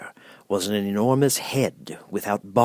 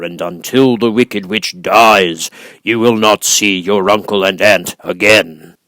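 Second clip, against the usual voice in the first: booming, much louder, very slow voice of the giant head; great and terrible Oz; 10 dB louder, slower than usual. Acted. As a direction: loom over her, slow and thundering. Oz dismisses Dorothy.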